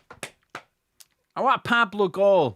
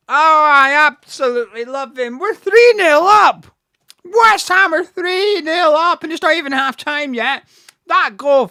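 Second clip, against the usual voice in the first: loud, higher, elated shouting; joyful roar; 7 semitones higher, 9 dB louder than usual. Real, not acted. Fan yelling to viewers about the three nil lead, elated roar. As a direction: bellow the scoreline in pure joy.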